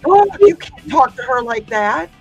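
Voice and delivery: ordinary talk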